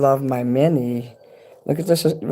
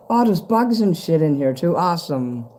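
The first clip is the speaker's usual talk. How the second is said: ordinary talk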